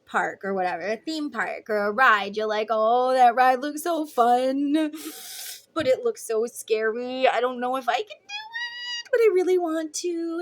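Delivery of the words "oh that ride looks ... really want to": torn and nervous. Retold or imitated. Nervous rider torn about ride.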